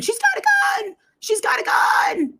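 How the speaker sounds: very high, strained mock scream; imitated screaming. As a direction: shriek it high, mimicking a panicked alarm. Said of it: "And she was screaming".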